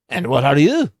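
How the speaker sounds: a half-hearted Scottish brogue; bad Scottish accent. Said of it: "that's a bad Scottish accent".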